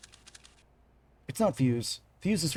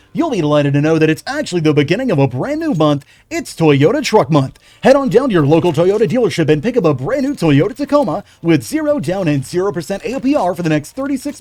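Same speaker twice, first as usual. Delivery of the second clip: louder hard-sell car commercial; radio ad read; 9 dB louder than usual. Pretend.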